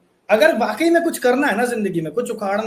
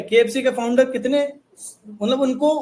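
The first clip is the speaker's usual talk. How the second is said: ordinary delivery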